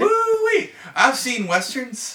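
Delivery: high, loud, hammed-up yokel gushing; mock awestruck local. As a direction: squeal it like a starstruck local gawking at a cowboy. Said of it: "everybody will be like".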